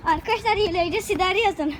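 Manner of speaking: ordinary talk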